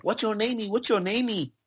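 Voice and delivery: high-pitched imitation of a little kid; high little-boy voice, accented English. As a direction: pipe it up like a curious small boy. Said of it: "there was this little boy".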